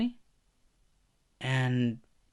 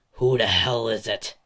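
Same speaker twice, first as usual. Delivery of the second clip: gruff, louder pickup; rude answer; 6 dB louder than usual. Acted. Bakugo answering the phone, loud and rude. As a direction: bark it into the phone. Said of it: "picks it up saying".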